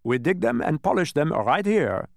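high, loud, eager explanation; volunteering. A narrator's reading. Dodecahedron explaining the workers' job, loud and forthcoming. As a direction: pipe up loudly to explain. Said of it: "volunteered the dodecahedron".

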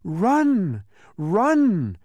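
high, loud warning cry; urgent shout. A narrator's reading. An unseen voice warning those on the trail, urgent shout. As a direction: shout to get them fleeing. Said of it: "it shouted again".

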